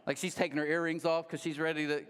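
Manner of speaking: normal talking voice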